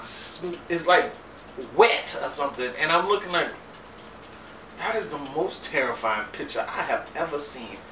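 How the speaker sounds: ordinary delivery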